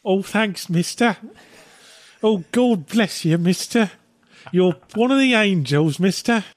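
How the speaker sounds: high, pleading mock urchin voice; grateful orphan. Orphan thanks passerby for a Switch, high urchin voice. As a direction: pipe up like a pitiful grateful waif.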